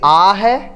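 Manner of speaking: "आ" said as a pure, drawn-out open aa; plain long aa. Shown as a demonstration. Teacher ruling out a vowel, "आ" held as pure aa. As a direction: stretch "आ" as a clear aa. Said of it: "आ और ओ के बीच".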